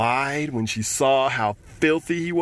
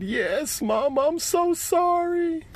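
high, slow sniffly plea; small voice still teary; child's teary apology to his mother; tearful apology; slower than usual. Acted. Boy apologises after crying.

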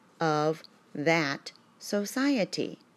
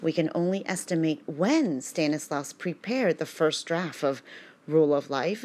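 normal talking voice

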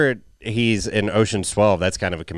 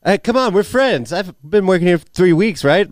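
overfamiliar new assistant; 9 semitones higher, 4 dB louder than usual. Acted.

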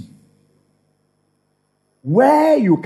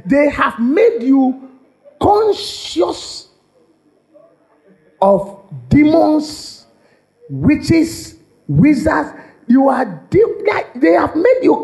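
half-laughing, higher and slower on conscious of demons; laughing exclaim; 7 semitones higher, slower than usual. Real, not acted. Preacher to congregation, laughing, high mocking exclaim.

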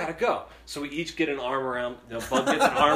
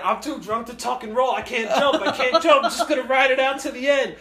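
put-on high, loud drunk resignation; drunk friend voice; 9 semitones higher, 5 dB louder than usual. Drunk friend in the rolling dumpster, high resigned drunk voice. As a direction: give up grandly, drunk and giggly.

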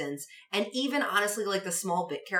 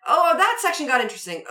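high and loud excited; 8 semitones higher, 7 dB louder than usual. Retold or imitated. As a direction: perk up at the good part. Mimicking viewer's reaction.

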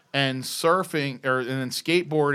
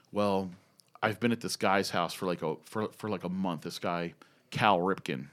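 low, hushed, reluctant admission; imitating a sheepish friend on the phone; retold glum confession; 8 semitones lower, 10 dB quieter than usual. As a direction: confide it softly, as a man in trouble.

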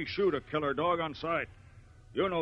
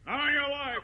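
flat-out refusal, pitched higher; angry refusal; 10 semitones higher than usual. Acted. Rancher refuses the girl's plea, angry and higher. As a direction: shut her down hard.

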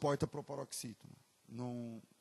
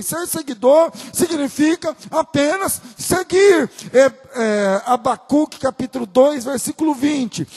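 rising louder and higher into a preaching shout; building to full preaching; 11 semitones higher, 18 dB louder, slower than usual. Acted. Pastor to the congregation, swelling into a full preaching shout. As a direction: build the sermon to a roar.

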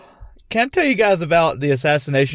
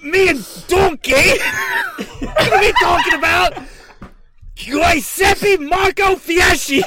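high, shouted hammy ogre; Guy Fieri as Shrek; 12 semitones higher than usual. Pretend. Naming the assassin as the requested mash-up, shouty impression. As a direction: belt it as an ogre hosting a food show. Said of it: "Guy Fieri as Shrek".

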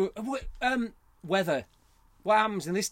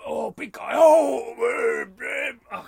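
booming, loud big-man voice, raised in pitch; Brian Blessed; 9 semitones higher, 12 dB louder, much slower than usual. Acted. Host attempting a famous voiceover artist, booming. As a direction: boom it out like a huge actor. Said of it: "Oh, Brian Blessed".

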